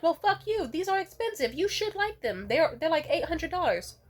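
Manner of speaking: high, loud mock outrage; indignant quote. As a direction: imitate an offended boyfriend yelling. Acting out boyfriend angry about gift.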